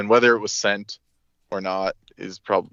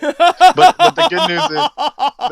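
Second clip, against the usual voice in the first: much higher and louder, slow; excited burst; 12 dB louder, much slower than usual. Real, not acted. Announcing the found wallet to friends, excited outburst. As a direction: blurt out the good news with a jolt.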